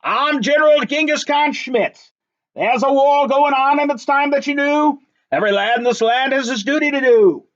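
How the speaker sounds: pompous general announcing his name; bellowing general. A narrator's reading. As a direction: puff up and bellow like a self-important general. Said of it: "he bellowed".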